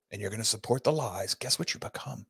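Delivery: hushed whisper warning; unvoiced whisper, ominous. Real, not acted. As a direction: lean in and whisper it. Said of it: "whispered warning".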